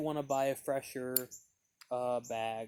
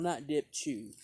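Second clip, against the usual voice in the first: ordinary delivery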